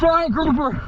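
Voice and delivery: high, thrilled exclaim; excited. Real, not acted. Diver spotting a giant grouper, thrilled and high. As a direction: call out the find with delight. Spots huge fish, excited.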